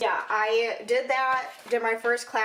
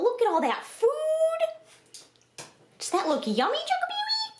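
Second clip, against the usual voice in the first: high-pitched, excited coo to a dog; squeaky-high doggy talk, stretched wows; pet talk; 13 semitones higher than usual. Real, not acted. Cooing to her dog about food.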